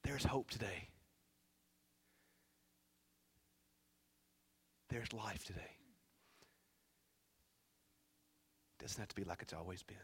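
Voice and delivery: soft, low reassurance after the climax; hushed preaching. Real, not acted. Preacher promising listeners hope today, hushed and low. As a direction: drop down and reassure gently.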